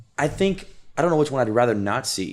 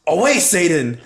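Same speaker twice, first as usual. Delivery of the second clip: higher, louder mock exorcist cry; retold scream; 6 semitones higher, 5 dB louder than usual. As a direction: cry it out like banishing the devil.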